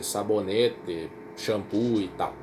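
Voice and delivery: usual speaking voice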